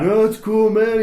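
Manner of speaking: high, loud laid-back California dude; Crazy Lance. Retold or imitated. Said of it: "He's like".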